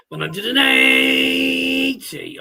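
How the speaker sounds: darts score caller. Retold or imitated. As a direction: stretch it like the darts announcer.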